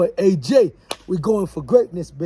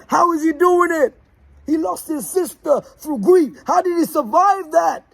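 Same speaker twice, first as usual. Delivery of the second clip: amazed onlookers; 11 semitones higher than usual. Retold or imitated. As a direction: sound like folks who can't believe he made it. People wondering about the speaker.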